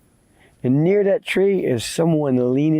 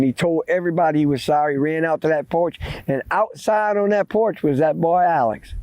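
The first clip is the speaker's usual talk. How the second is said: usual speaking voice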